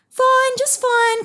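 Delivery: put-on very high and slow, sweet child voice; a small child answering brightly from up a tree; little koala's reply. A narrator's reading.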